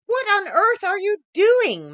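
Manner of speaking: high, slow, startled Maggie; astonished question. A narrator's reading. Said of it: "Maggie asked".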